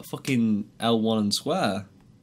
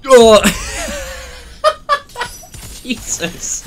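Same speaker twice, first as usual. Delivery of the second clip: startled yelp, then high laugh; shriek into laughter; 11 dB louder, much slower than usual. Real, not acted. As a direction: scream then crack up laughing. Startled by monster, bursts laughing.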